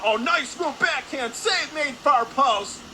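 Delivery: high, loud burst on the save; excited play-by-play. Real, not acted. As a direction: call a big save as it happens, voice leaping up. Live call of a big save.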